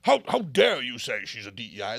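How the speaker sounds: hammed-up imitation of a scandalised critic; mock outrage. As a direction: sneer out the offended reaction. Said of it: "they're like".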